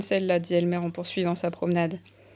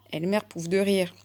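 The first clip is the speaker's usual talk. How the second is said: ordinary delivery, nothing marked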